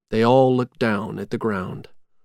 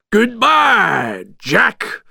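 high, loud villain's taunt; shouted farewell; 10 semitones higher, 5 dB louder, much slower than usual. A narrator's reading. Villain sending knives at Jack, gloating shout. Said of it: "shouted Oogie Boogie".

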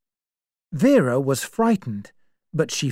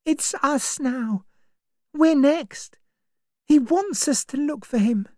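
put-on higher, anxious young woman; young woman's voice; 12 semitones higher than usual. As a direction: voice Vera as uneasy and searching. Vera's fearful warning.

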